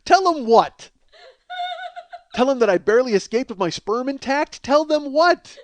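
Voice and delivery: loud, high comic outrage; worked-up, hammed-up bewilderment; incredulous shouting. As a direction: demand an answer in disbelief. Mock outrage at her farewell.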